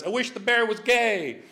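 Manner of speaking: a hint of a triumphant bunny; lightly voiced bunny. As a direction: call it out as the bunny rides off. Bunny's punchline wish while riding away.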